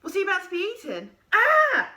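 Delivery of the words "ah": high, loud frightened yelp; creature's scream. A narrator's reading. Creature startled by Stitchhead's scream, loud panicked shriek. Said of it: "screamed the creature".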